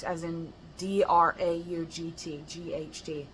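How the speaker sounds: normal talking voice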